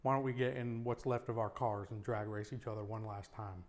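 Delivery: ordinary talk, nothing marked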